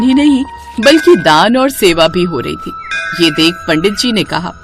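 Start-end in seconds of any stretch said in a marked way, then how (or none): none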